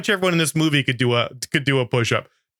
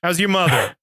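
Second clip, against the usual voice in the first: mock demanding customer; 8 semitones higher than usual. Customer yelling order at chef.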